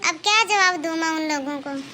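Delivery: low, subdued helplessness; resigned. Acted. Daughter-in-law tells sister-in-law she cannot answer back, resigned.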